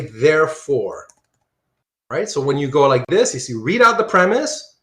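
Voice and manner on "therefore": "Therefore" drawn out slowly with a falling end. Shown as a demonstration. Teacher showing students how to read the arrow downward, slow "Therefore". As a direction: stretch "Therefore" and let it fall. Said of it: "you're gonna say".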